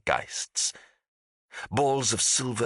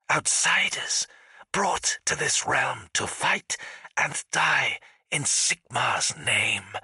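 whispery, high spectral voice; otherworldly voice; 6 semitones higher than usual. A narrator's reading. Unseen voice reassuring the confused ghost, eerie and whispered. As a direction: answer in a slow, eerie half-whisper.

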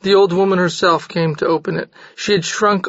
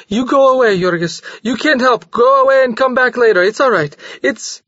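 rushed urging; 6 semitones higher than usual. A narrator's reading. Maria urging Jorgis to leave, rushed. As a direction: hurry him out the door. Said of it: "she rushed on".

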